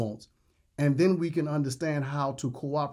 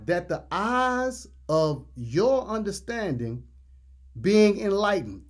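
slow, drawn-out reading, pausing before the last phrase; measured preacher's reading, pitch slightly lifted; slow scripture reading; 4 semitones higher, slower than usual. Real, not acted. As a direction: read each phrase out deliberately. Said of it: "we're gonna read this slowly".

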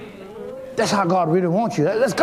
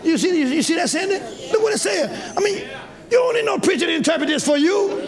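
preaching at full force; 12 semitones higher than usual. Acted. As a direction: shout the point at the congregation. Preacher building to a shout.

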